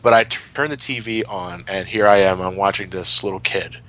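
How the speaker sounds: ordinary talk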